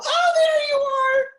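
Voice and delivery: very high, loud, delighted squeal; gleeful peekaboo reveal. Shown as a demonstration. Lecturer finding the vanished people in peekaboo, exaggerated delight. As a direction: burst out as if they just reappeared.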